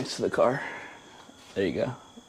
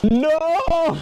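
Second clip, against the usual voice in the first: shocked yelp; 4 dB louder than usual. Real, not acted. Oil spill under car.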